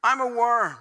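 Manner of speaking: high, emphatic reasoning turned on oneself; anguished self-blame. Acted. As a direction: argue it out in frustrated distress. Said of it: "so he reverts and he goes".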